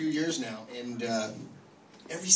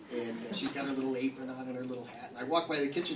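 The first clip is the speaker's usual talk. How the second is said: usual speaking voice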